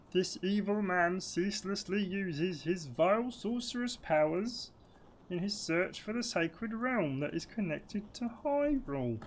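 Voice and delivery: high, slow, swooping theatrical voice; grand, drawn-out game exposition; storybook tree voice. Acted. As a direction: intone it slowly as an ancient storyteller tree. Reads the tree's exposition.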